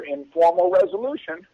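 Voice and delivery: usual speaking voice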